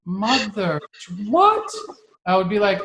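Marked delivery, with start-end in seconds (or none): 0.0-1.7 prim gasp of disbelief, the last word leaping way up; mock shocked. Dad mocking a prudish reaction to his daughter's swearing, shocked. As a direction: play the scandalised parent, squeaking the what. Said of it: "You know, I wouldn't be like".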